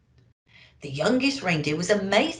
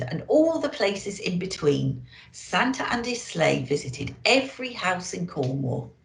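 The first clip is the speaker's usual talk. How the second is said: usual speaking voice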